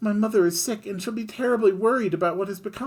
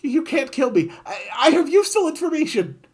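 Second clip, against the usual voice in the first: much higher, loud frantic babbling; panicked pleading; 8 dB louder than usual. Acted. Griffin begging Kid for mercy, high frantic panic. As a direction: grovel for your life.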